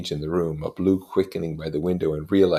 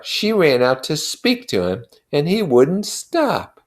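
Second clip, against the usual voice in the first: louder, stubborn voice, slowing at the end; Wilson, grimly certain; 8 semitones higher, 9 dB louder than usual. A narrator's reading. Grieving husband insisting to his friend he knows the truth. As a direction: hammer home what he knows.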